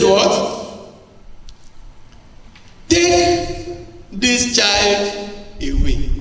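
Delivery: high, sing-song royal command, slowed; hammed-up princess voice, drawn out; Pharaoh's daughter. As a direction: sing out her order like a princess. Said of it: "That voice you are hearing there".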